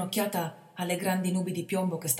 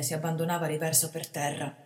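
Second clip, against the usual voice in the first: ordinary delivery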